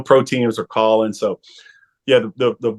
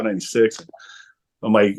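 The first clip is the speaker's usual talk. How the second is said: usual speaking voice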